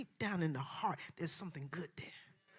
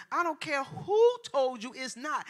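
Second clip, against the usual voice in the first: normal talking voice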